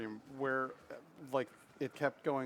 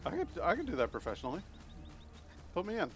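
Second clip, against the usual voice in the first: eager; 8 semitones higher, faster than usual. Pretend. Eager mock plea to coach.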